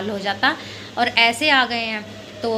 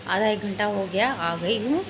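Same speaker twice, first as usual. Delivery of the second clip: ordinary talk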